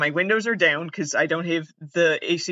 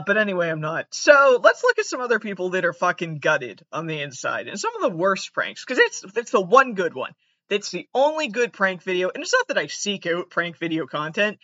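normal talking voice

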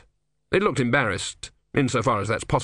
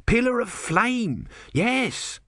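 high, slow dawning recall; sudden recognition; 12 semitones higher, much slower than usual. A narrator's reading. Tortoise god recalling his prophet, dawning recognition. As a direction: remember it with relish, high and slow.

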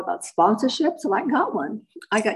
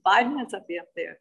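normal talking voice

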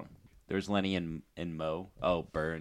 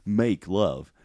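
slow, dopey cartoon dad; drawn-out goofy character voice; much slower than usual. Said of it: "I can do Goofy".

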